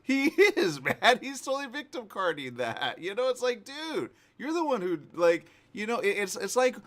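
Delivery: loud, high, exasperated; indignant and animated; worked-up agreement. Real, not acted. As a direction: burst out agreeing, pitch way up. Excited agreement about victim act, indignant.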